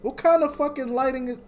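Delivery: high, loud frustrated complaint; exasperated rant. Real, not acted. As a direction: vent at the game loudly. Complaining about darkness.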